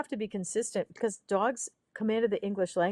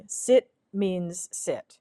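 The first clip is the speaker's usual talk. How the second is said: firm, slow; much slower than usual. Shown as a demonstration. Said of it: "firm command demo".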